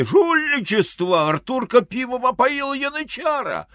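high, indignant outcry; outraged accusation. Acted. Gambler running out to accuse Arthur, high outraged cry. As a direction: cry foul at a high pitch. Figure runs out crying foul.

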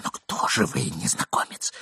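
soft fearful whisper. A narrator's reading. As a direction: breathe the question. Hushed question in hiding.

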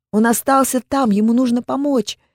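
high loud frantic plea; desperate pleading. A narrator's reading. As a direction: beg desperately to go back. Heroine pleads to save Lambert.

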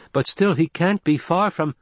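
eager youngster protesting; young boy or girl. Acted. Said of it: "The voice of a young boy or a girl".

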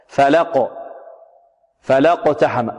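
"فلقتحم" with la running straight into qaf; hamza dropped in joining. Shown as a demonstration. Teacher demonstrates joined reading, "فلقتحم" without the hamza. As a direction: join "فلقتحم" as fa-laq-ta-ham, no glottal stop. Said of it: "أما في الوصل تحذف".

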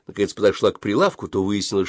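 plain reading voice

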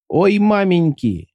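high, slow mannered squeak; Chucky's squeak. Acted. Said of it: "Только и успел пискнуть манерный Чаки".

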